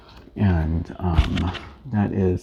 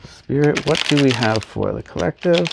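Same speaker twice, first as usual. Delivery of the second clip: higher, louder invocation; calling out; 6 semitones higher, 3 dB louder than usual. Acted. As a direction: summon the spirit aloud. Calling on spirit for reading.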